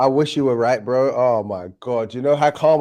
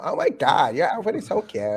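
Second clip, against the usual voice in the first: put-on bubbly high-pitched American valley-girl voice; 6 semitones higher than usual. Asked for his American accent, valley-girl American gush.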